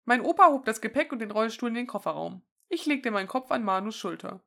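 usual speaking voice

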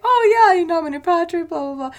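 high, loud opening, then tossing off a blessing; mock Italian priest. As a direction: imitate a little priest rattling through Latin. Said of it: "this little Italian priest is like".

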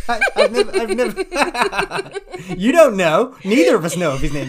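high, loud laughter through the words; laughing hard. Real, not acted. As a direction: crack up while protesting. Caught lying about the name.

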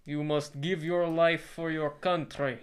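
slow, loud mock-Russian oration; hammed-up Russian version of a presidential speech; Russian accent. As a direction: declaim it in a thick Russian accent. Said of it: "That's the Russian version".

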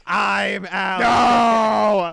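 drawn-out loud mock announcement; mock claim. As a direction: proclaim it slowly and loudly. Jokingly claims to be cousin.

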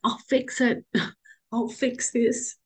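high, tearful voice, words catching; fighting back tears; tearful promise. Real, not acted. Tearfully promising her daughters she'll survive. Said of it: "sorry, I get really emotional".

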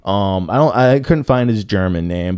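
usual speaking voice

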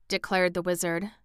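plain reading voice

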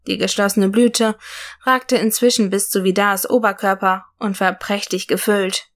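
plain reading voice

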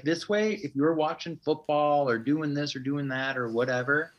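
husband mimicking his wife explaining herself; wife's voice. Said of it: "she's like".

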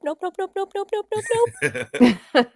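high, fast panicked nope chant. Pretend. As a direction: squeal nope while fleeing. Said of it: "Finch's action is going to be nope".

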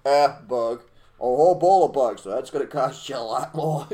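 loud scoffing haggle; mock haggling. Haggling with someone who wants him to eat bugs, loud. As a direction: bark out the price like a hard bargainer.

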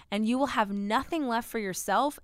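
ordinary talk, nothing marked

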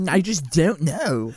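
put-on loud, high, drawn-out Baltimore accent; goofy surfer-Baltimore accent, slow and loud; mock Baltimore accent. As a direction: laugh through a hammed-up Baltimore accent. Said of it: "like, a Baltimore accent".

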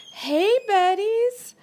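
slow, high cooing greeting. Real, not acted. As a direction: coo at the birds like babies. Calling to pet birds.

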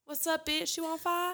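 high mock-tough challenge; high-pitched playful taunt; voicing a rooster. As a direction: goad like a cocky little rooster. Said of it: "He's like".

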